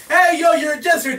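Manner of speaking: a hint of a heckler; heckling onlooker. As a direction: call out to the jester. People are like.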